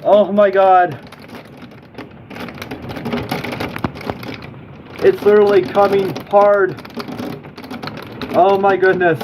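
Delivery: high, frightened voice; scared, pitch held up high; real panic. Real, not acted. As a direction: plead with alarm as danger builds. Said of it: "I'm literally having a panic attack".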